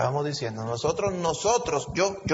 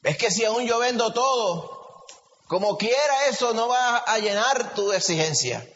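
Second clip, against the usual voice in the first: pleading believer; 7 semitones higher than usual. Acted.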